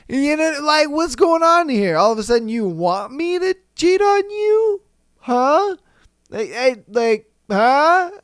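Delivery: high-pitched incredulous questioning; disbelieving, rising into a high question; mock bewilderment. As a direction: play the baffled boyfriend, voice climbing. Plays the baffled accused boyfriend.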